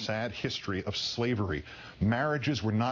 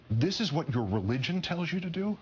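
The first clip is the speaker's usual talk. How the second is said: high-pitched, disbelieving question; impassioned rhetorical question, pitched up; incredulous challenge; 7 semitones higher than usual. Real, not acted. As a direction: challenge them in rising disbelief. Rhetorical challenge to opposing voters.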